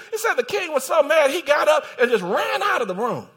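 loud, high-pitched sermon delivery; fired-up pulpit storytelling; full-force preaching. Real, not acted. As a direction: preach it at a shout, driving through the story.

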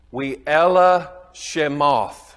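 We'ela Shemoth drawn out slowly, each syllable spaced, with a Southern American twang on the Hebrew; southern-accented Hebrew. Shown as a demonstration. Pastor showing the class the Hebrew title, slow southern-accented Hebrew. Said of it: "That's my southern slang on that".

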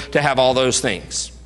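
usual speaking voice

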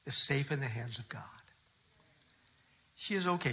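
soft, hushed reassurance, near a whisper; gentle comfort. Retold or imitated. Pastor consoling grieving widower, hushed and tender. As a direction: soothe him quietly, tenderly.

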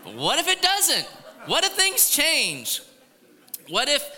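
high, loud, slowed what-if; booming question to the congregation; dramatic warning. Real, not acted. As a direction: drop the hammer on the crowd. Sudden turn to warning.

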